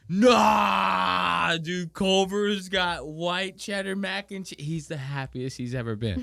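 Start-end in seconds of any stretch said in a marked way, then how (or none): 0.1-4.6 mock excited Nick. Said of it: "He goes".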